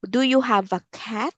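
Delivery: quick, casual question; fast question. Shown as a demonstration. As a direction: toss it off quickly. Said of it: "repeated slower".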